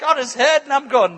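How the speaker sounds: ordinary talk, nothing marked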